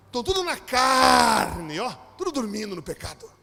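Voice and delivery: very loud, slow, hammed-up shout of a church sister; mock fiery rebuke; preachy shout. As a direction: bellow it like a zealous old sister.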